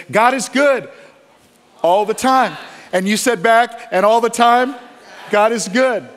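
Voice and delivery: loud, high call-and-response chant; worship leader call and response. Retold or imitated. Worship leader rallying the congregation, loud and high. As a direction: echo the worship leader's rally cry to the crowd.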